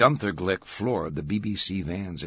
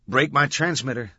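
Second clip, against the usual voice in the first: higher, louder sharp caution; warning; 7 semitones higher, 6 dB louder than usual. A narrator's reading. Macri warns Glick as her gear slides, warning. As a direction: warn him off firmly. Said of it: "she warned".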